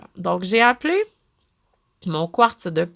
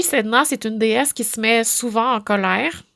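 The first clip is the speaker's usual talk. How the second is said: normal talking voice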